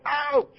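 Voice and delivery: a high, yelped "Ouch!", played for the kids; mock ouch. Preacher to children, mimicking the Israelites' pained "Ouch!" in a high yelp. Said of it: "They're always crying out".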